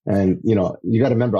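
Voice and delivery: usual speaking voice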